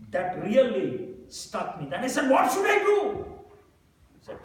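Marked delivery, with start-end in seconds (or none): 2.3-3.2 desperate question. Retold or imitated. Desperate plea to friend.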